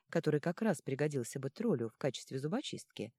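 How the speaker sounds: usual speaking voice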